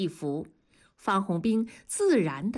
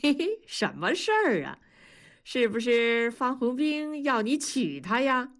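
cheeky grin; 5 semitones higher than usual. A narrator's reading. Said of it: "大庄嬉皮笑脸地说".

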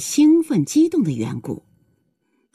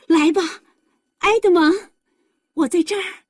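put-on high-pitched happy girl calling; eager calling out; 11 semitones higher than usual. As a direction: call to a loved one, pitch high and excited. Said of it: "一边大声说". (兴奋地高声招呼)

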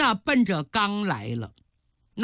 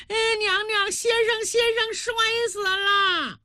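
put-on very high, wailing child voice; panicked child; 11 semitones higher than usual. A narrator's reading. Child telling the queen of an accident, high panicked wail. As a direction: cry out the bad news as a frantic little boy.

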